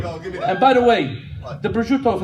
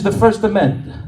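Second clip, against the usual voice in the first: a hint of a stern old Italian laying down the law; mild Italian accent, emphatic; Italian grandpa; 2 semitones higher than usual. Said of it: "He says".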